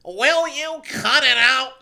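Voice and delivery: badly faked, high, drawn-out cartoon shout; screaming Squidward. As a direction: scream it slowly in a terrible impression. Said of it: "burst through the door and screamed".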